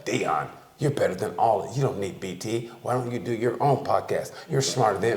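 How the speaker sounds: low, soft flattering coo. Retold or imitated. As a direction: sweet-talk him softly. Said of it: "she'll gas you up like".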